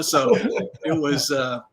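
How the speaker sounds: usual speaking voice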